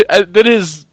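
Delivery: ordinary talk, nothing marked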